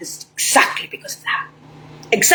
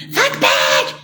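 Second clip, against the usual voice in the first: mock yell. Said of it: "In the U S, I'm like".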